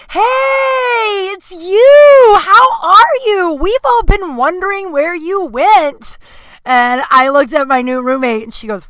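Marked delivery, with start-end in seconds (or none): 0.1-6.2 loud high shouted greeting; excited shout. Retold or imitated. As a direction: yell it like you've spotted an old regular. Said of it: "and the guy is like".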